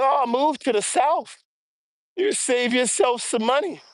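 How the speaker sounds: imitating relocation advice. Walker mimicking people who say move South, mock advice voice. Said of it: "You know how sometimes they're like".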